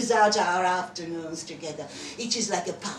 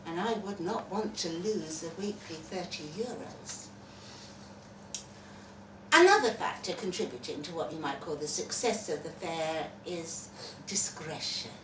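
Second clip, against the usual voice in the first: usual speaking voice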